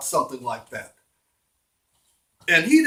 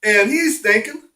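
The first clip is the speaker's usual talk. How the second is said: ordinary delivery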